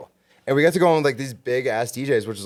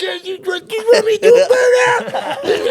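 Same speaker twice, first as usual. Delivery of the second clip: much higher, louder, slow squeaky mock taunt, laughing; mocking impression; 7 dB louder, slower than usual. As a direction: ham up the guys' dumb reply as a joke. Mocking the other guys' reply.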